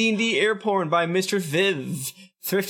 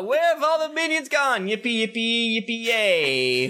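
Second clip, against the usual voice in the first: 1 playful mock cheer; 6 semitones higher than usual. Reading a fan review aloud.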